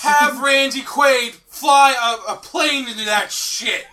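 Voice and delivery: mock rousing shout. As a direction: shout it like a hammed-up rallying cry. Joining in the mock yelling.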